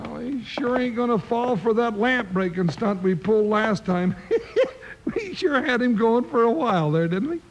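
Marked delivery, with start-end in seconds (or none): 4.2-5.1 short breathy chuckle. Acted. Deputy chuckling to his partner about the stunt. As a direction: laugh off the memory.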